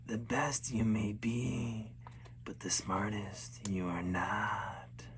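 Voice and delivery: slow eerie creature whisper; whisper like blowing snow. Acted. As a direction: breathe it out like wind over ice. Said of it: "a voice that sounded like snow blowing over the ice".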